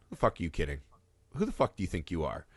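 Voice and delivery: mock your own reflection under your breath; scornful self-talk. Speaker sneering at their own mirror reflection, low and soft.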